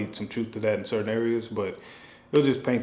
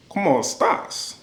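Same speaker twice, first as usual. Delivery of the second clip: a mock Latin accent, pitched higher; 7 semitones higher than usual. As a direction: toss off a Spanish greeting in a Latin accent. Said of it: "it'll be like a Latin accent".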